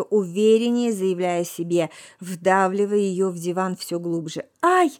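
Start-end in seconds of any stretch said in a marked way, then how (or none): 4.6-4.9 short, sudden cry of pain; startled "ow" cry; pained yelp. A narrator's reading. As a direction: yelp as if burnt. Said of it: "вскрикнула Маруся".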